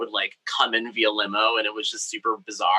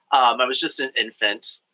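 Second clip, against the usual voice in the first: usual speaking voice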